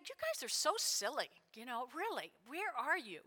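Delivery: soft, teasing reproach; incredulous aside. Acted. Preacher reacting to the ambitious brothers, softly incredulous. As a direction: chide them quietly in disbelief. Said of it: "And I'm going".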